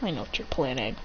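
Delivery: low, hushed aside with a smirk; knowing smirk. Acted. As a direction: say it softly, like you see through them. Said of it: "with a smirk on his face".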